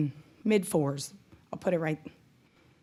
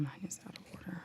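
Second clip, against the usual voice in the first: very soft, near-whispered aside to oneself; hushed aside; 17 dB quieter, faster than usual. Real, not acted. Treasurer to herself over papers out of order, hushed aside. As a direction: say it under your breath.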